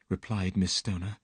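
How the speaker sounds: ordinary delivery, nothing marked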